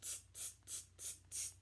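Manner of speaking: quick voiceless "tss" clicks of mimicking monkeys; cheeky monkey tsking; monkey chatter tsks. A narrator's reading. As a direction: chitter "tss" softly, evenly, without voice. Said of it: "the monkeys ... and said".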